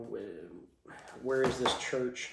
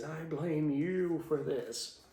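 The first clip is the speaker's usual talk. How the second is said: accusing duke; 4 semitones higher, slower than usual. Acted. Dying duke accuses Dork.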